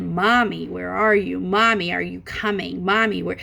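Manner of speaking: ordinary delivery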